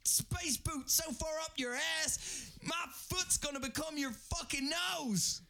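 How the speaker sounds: furious shout. Acted. Commander screams threats at his officer Watson. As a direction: blow up at the subordinate.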